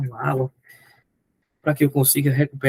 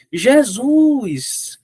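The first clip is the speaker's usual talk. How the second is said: higher and louder cry of the name; blind man's cry; 7 semitones higher, 4 dB louder than usual. Retold or imitated. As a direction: call out the name as a plea. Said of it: "quando ele deu esse grito". (grito do cego, mais alto)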